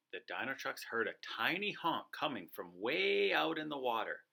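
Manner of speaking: usual speaking voice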